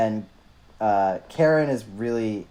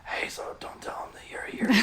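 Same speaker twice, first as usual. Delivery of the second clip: conspiratorial whisper; 12 dB quieter than usual. Retold or imitated. Cassie to the injured Karen, hushed conspiratorial whisper. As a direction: whisper it secretly. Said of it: "and is like".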